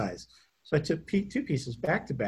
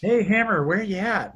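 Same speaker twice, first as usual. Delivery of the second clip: put-on drunk voice; 8 semitones higher, 5 dB louder than usual. Imitating hungover Greg Valentine.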